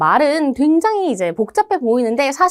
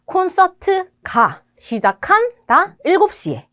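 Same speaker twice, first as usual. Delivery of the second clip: slow keyword gloss; 6 semitones higher, slower than usual. Shown as a demonstration. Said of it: "내용어가 중요합니다".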